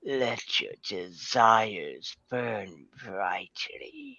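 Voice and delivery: slow, breathy whispered cat voice; sultry cat whisper. Pretend. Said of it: "in my cat impression".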